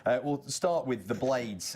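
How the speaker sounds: normal talking voice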